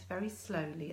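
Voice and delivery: ordinary talk, nothing marked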